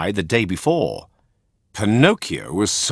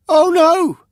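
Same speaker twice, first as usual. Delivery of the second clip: high, loud shriek of alarm; 7 dB louder than usual. Acted. Puppet discovering donkey ears, shrieking. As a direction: shriek out in horror. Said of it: "shrieked Pinocchio".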